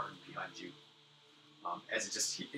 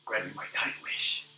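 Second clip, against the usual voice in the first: put-on feeble old man; 8 dB louder, slower than usual. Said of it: "He says".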